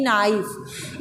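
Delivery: नाइफ said softly as naif, K silent; 1 model word. Shown as a demonstration. Teacher modelling the word for the class, silent K. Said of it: "इसमें हम इसको कनाइफ नहीं बोलेंगे".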